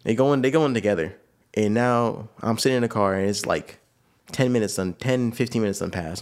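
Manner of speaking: ordinary talk, nothing marked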